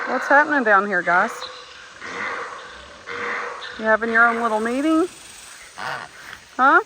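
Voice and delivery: high, sing-song voice for animals; pet talk. Real, not acted. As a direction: coo at the donkeys like pets. Talking to her donkeys.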